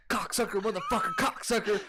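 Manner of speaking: mock angry cursing, high-pitched; Zac Efron, angry. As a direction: imitate a furious tirade, half laughing. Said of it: "came in angry as fuck".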